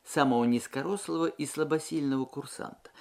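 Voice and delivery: plain reading voice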